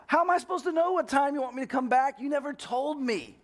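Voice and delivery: imitating himself shouting back, high; retold yelling. Son yells back at his dad about curfew, high mock yell. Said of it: "I start yelling at him".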